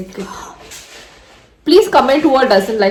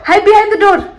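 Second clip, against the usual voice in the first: playful; 7 semitones higher than usual. Acted. Hide and seek with child.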